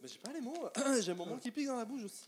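mimicking his friend in a high voice; mocking imitation. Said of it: "comme Guillaume, il a dit tout ce que j'avais à dire". (imitation moqueuse, voix aiguë)

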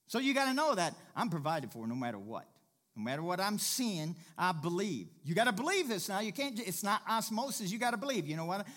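loud, urgent exhortation; full-force preaching. Real, not acted. Preacher urging church to believe they are provided for, fired up.

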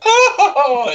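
high swooning oh; fainting gasp. Retold or imitated. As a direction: gasp like a belle about to faint. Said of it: "using to be like".

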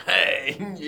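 put-on loud croaky old hedgehog hey; old hedgehog greeting. Old hedgehog greeting the council envoy, loud croak. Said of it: "Un arici bătrân".

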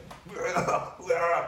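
high, garbled grumbling noise; wordless noise mocking a sore loser; mock bitter noise. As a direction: make a silly bitter blubbering sound. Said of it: "I'm not gonna be like".

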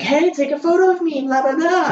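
very high, loud mock-excited partygoer voice; hammed-up high voice of a partygoer asking for a picture; eager partygoer. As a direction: squeal it like a tipsy clubgoer begging for a photo. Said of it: "they'll come up to you and be like".

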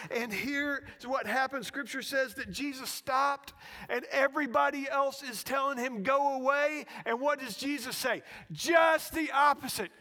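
loud, high preaching that builds; full-force preaching. Real, not acted. Preacher telling congregation Jesus stopped, full-force preaching. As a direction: build loudly to the turning point.

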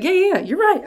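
breezy high yeah yeah; mock agreement. God sarcastically agreeing with proud Israel. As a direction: agree lightly, then set the trap.

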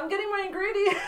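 high, loud reply through laughter; laughing role-play. Pretend. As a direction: play along with the silly bit, cracking up. Acting out fetching flour from sheep.

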